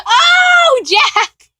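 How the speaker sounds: very high, loud shriek of recognition; real high-pitched outburst of delight; excited squeal. Real, not acted. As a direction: squeal the name like a fan.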